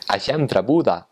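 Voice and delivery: nasal Northern Norwegian, swooping on Buda; Northern Norwegian dialect. Shown as a demonstration. Showing a favorite phrase from the north, Northern Norwegian dialect. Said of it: "from the north is".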